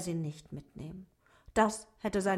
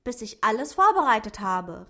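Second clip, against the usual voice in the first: put-on boy calling out louder to the duck; a boy's eager shout into the garage; 2 semitones higher, 7 dB louder than usual. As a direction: call it out so the duck hears. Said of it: "rief Simon".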